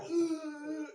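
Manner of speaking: a high, hammed-up groan of effort; mock straining groan. Showing viewers the wrong way, a mock straining groan. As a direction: ham up a slouching groan, like it's too hard. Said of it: "you don't wanna be all like".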